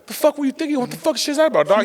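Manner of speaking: high, exasperated scold; dad scolding his son, exasperated. Retold or imitated. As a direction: let him have it. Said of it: "but I was like".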